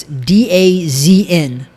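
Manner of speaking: "D A Z N" letter by letter; slowly name each letter, "D A Z N"; spelled out. Shown as a demonstration. Host spells the streaming name for listeners, "D A Z N" letter by letter. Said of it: "is how it is".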